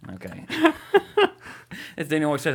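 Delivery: ordinary talk, nothing marked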